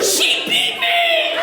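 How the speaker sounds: very high, drawn-out mock wail; hammed-up shrieky childish tattle; tattling little sibling. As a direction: wail the accusation like a bawling kid.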